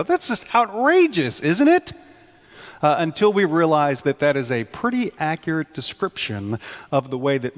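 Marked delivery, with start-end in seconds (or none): 0.0-1.9 high, incredulous that's outrageous; a mock gasp of outrage. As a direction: play up the disbelief. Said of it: "supposed to kind of go".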